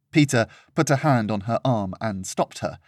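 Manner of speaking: usual speaking voice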